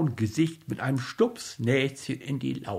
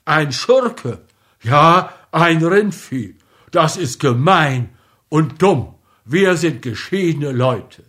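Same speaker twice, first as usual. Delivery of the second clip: loud, indignant scolding; a wounded lady cutting ties loudly; indignant outburst; 3 semitones higher, 8 dB louder than usual. Acted. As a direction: throw the insults at him in outrage. Offended lady denounces Pawel.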